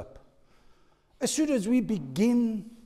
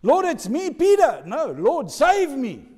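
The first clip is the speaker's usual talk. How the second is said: higher, loud pleading to the Lord; urgent call; 9 semitones higher, 8 dB louder than usual. Acted. Peter in the water pleading with Jesus, loud and urgent. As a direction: call out over the waves.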